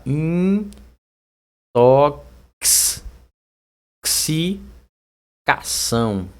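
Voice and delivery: "intoxicação" hugely stretched, IN... TOK-S... SI... CA-ÇÃO; very slow "intoxicação" with long K-S; pronunciation demo. Shown as a demonstration. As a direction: sound out "intoxicação" piece by piece. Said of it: "vai ficar assim".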